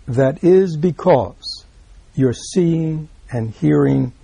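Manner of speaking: very slow, each word placed; slow weighty quote. Acted. Teacher reads the clincher to his students, very slow. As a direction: let the key line land slowly.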